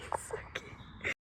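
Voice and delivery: soft slow whisper full of delight; awed whisper. Real, not acted. Vlogger whispering to viewers about the wildlife, awed whisper. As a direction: hush it like the animal is still close.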